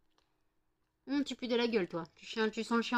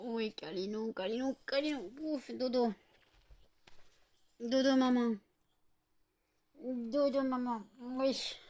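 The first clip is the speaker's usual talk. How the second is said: plus aiguë, chantonnée, câline; bêtifie comme à un bébé; voix bébé pour le chien; 4 semitones higher than usual. Real, not acted. Bêtifie avec son chien. (voix de bébé pour son chien)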